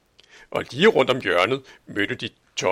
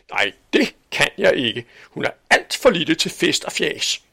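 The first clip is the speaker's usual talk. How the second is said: a lightly voiced prim spinster refusing; firm, fussy refusal; stern Aunt Sofie; 5 semitones higher, 5 dB louder than usual. Tante Sofie afviser igen. (let markeret streng tante Sofie)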